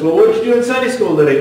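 quick, bright parental question. Retold or imitated.

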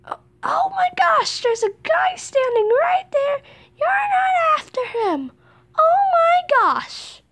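high shouted disbelief; shocked excitement. Real, not acted. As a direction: yell it in disbelief. Seeker misses nearby player.

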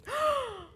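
sharp, high gasp; shocked gasp. Real, not acted. Reacting to the show.

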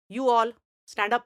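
slow, loud shouted command; shouted order. Acted. Teacher shouts at the seated students, slow and loud. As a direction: bellow the order, spacing each word. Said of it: "ते ओरडले".

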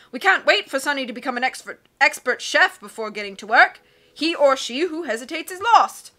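loud, hearty captain voice; blustery sea captain. Acted. Captain puzzling over charts, loud and hearty. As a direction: boom like a cheerful old sea dog.

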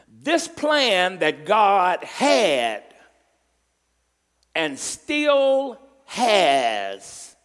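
drawn-out, weighty preaching; slow deliberate preaching. Acted. Pastor pressing the point to the church, slow and deliberate. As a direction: lean on every word slowly.

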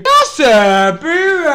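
very loud drawn-out holler; sudden yell. Real, not acted. Streamer begging for a good pack, sudden hollered outburst. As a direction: burst out at full volume. Yells out during pack opening.

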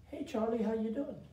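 put-on lightly high, squeaky little boy; a hint of a squeaky child; squeaky kid voice. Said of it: "Little squeaky voice".

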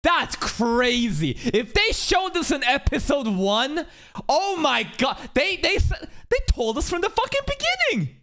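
shocked outburst. Real, not acted. Viewer reacting to an episode one reveal, shocked. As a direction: blurt it out in high-pitched disbelief.

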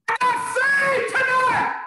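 joyful shout of a newly saved man; shouting at the top of his lungs. Retold or imitated. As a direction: bellow it out to the whole cellblock. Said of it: "I shouted at the top of my lungs".

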